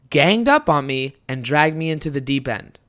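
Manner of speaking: usual speaking voice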